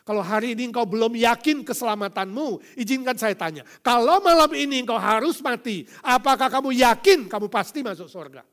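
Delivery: loud, high preacher's cry; preaching at full force. Acted. Pastor crying out God's power over all to the congregation, loud and high. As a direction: proclaim it to the whole hall.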